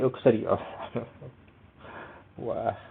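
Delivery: usual speaking voice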